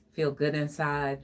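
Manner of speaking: normal talking voice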